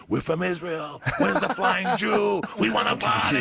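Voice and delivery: high, loud imitation of excited fans; rowdy mock shouting. Israeli fans accosting the comic at the club, in mock rowdy shouts. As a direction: holler like fans demanding a party.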